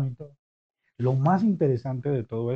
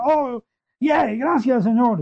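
high, happy burst of thanks; bright high delighted thanks; joyful thanks; 8 semitones higher, 3 dB louder, slower than usual. Retold or imitated. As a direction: cheer like you've just been healed. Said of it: "healed lepers rejoicing".

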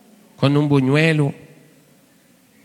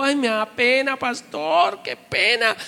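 much higher, bashful whine; embarrassed young man; 10 semitones higher, much slower than usual. Retold or imitated. Shy brother confessing embarrassment to the pastor, high. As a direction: whimper with shyness.

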